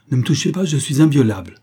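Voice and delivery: abrupt, high, loud protest; sudden cry. A narrator's reading. Cornered minister forbids arrest, crying out abruptly. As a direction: cry out to ward them off. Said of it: "l'homme s'écria brusquement".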